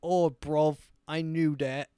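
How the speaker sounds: mock-British bloke, dopey; hammed-up dumb Brit reply; dim British accent. Brit told his book was upside down, dim British accent. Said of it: "Just a Mongoloid British person".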